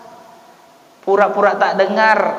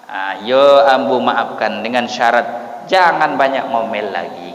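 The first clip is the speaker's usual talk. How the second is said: husband's reply; 7 semitones lower, slower than usual. Retold or imitated. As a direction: grant pardon with a condition. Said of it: "kata suami".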